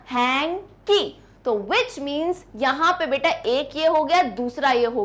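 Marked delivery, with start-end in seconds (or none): 0.1-1.0 हैंकी split as HANG, pause, KEE; broken into syllables. Shown as a demonstration. Teacher breaks a word for students, each syllable separate. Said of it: "break करके बोल सकती हूँ".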